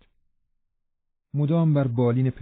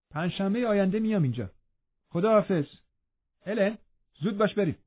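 hurried farewell; 8 semitones higher, faster than usual. Acted. Catherine mounts and hurries off.

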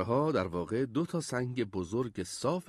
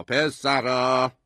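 louder, drawn-out call; calling for attention; 7 dB louder, much slower than usual. A narrator's reading. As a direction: call the boys to order, stretching the word. Father raises call for order.

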